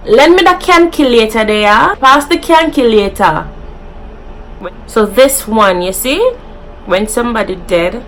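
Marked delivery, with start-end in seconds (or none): 0.1-3.5 lightly voiced Jamaican patois with calculator as "kankilieta"; a hint of Jamaican patois. Shown as a demonstration. YouTuber acting out a Jamaican asking, patois. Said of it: "we say".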